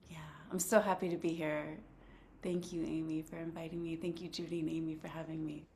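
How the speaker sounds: faint, distant voice far from the mic; speaking very softly, hard to hear; barely audible. Real, not acted. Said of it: "you sound a little faint".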